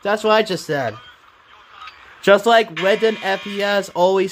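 high excited chatter; eager, high-pitched agreement. Real, not acted.

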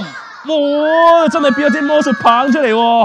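high, loud drawn-in wonder; showman's amazement. Pretend. Magician marvels at the wand that appeared, amazed. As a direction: marvel loudly for the children.

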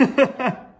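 short laugh, out of breath; breathless laugh. Real, not acted. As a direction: laugh it off, winded. Laughs at her own joke.

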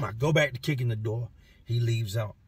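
normal talking voice

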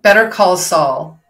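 put-on American accent with sounded R. Shown as a demonstration. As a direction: say it the American way for the listener. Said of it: "I'll say it in an American accent".

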